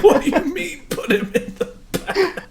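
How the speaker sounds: high, loud protest through laughter; laughing disbelief. Real, not acted. Teammate answers the absurd bag order, laughing in disbelief. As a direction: object incredulously while cracking up.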